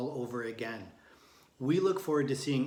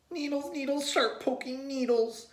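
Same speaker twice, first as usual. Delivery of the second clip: high yelling complaint; yelled; 12 semitones higher, 3 dB louder than usual. A narrator's reading. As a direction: cry out about the needles. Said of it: "yelled Tate".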